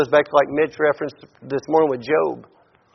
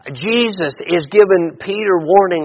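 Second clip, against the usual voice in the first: usual speaking voice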